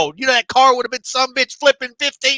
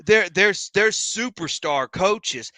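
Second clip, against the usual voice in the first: lower, slower, softer mock gushing; mock gushing pundit; 7 semitones lower, 4 dB quieter, slower than usual. Mocking national media praising the new coaches, fawning. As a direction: play a fawning pundit, drawn out and soft. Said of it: "they're like".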